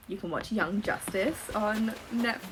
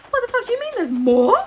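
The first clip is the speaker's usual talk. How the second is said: high, loud disbelieving shriek; shocked squeal at the tigers twist; shocked outburst; 13 semitones higher, 7 dB louder than usual. Retold or imitated. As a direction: blurt it out, stunned there's more. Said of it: "I'm like".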